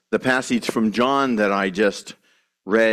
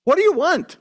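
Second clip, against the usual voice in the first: high, whining complaint; whiny voice; 8 semitones higher than usual. Retold or imitated. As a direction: whine it like a spoiled child. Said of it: "asking in a whiny voice".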